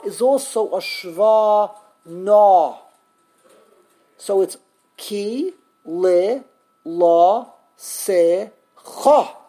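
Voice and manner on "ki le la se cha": KI, LE, LA, SE, CHA, each held alone; syllable by syllable. Shown as a demonstration. Teacher counting out a rare five-syllable word, syllable by syllable. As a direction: sound out five separate punched syllables. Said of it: "Five syllables".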